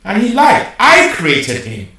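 high, loud indignant shout; angry rant. Acted. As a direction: rage at the idea of a rival. Dictator character rants.

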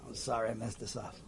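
very soft, low, heartfelt sorry; tender quiet apology to his son; sincere apology. Retold or imitated. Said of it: "I just wanna say sorry".

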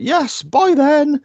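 high, slow mock-cheery goodbye; a lightly sung farewell; breezy dismissal. Stepdad leaving her on the boat, slow high cheery goodbye. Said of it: "And he's like".

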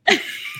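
a quick high giggle; short laugh. Real, not acted. Author giggling while correcting herself about drinking, quick high giggle. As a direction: giggle at your own joke.

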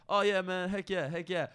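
Designer. Retold or imitated. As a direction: imitate a friendly, positive rapper. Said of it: "I'm, like, a friendly rapper".

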